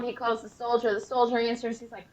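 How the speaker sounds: usual speaking voice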